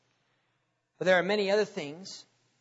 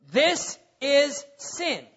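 slow, higher, spaced-out words; stern reproof; 5 semitones higher, much slower than usual. Acted. Preacher naming sin to the congregation, stern and deliberate. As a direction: pronounce it as a verdict, word by word.

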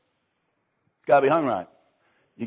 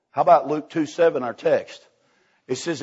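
ordinary delivery

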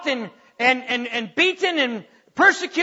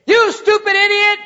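loud angry snap at a driver; road rage insult; 7 semitones higher, 6 dB louder than usual. Retold or imitated. Parent yelling at a bad driver, loud angry snap. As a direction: yell it at the car ahead. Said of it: "you get mad at a driver and say".

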